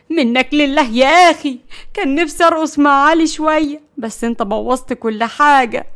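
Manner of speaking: a ruined night, and it's his fault; exasperated curse. Acted.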